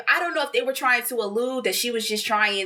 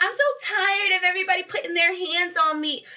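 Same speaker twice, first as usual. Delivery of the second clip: whiny mock complaint; 8 semitones higher than usual. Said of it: "And Bria's like".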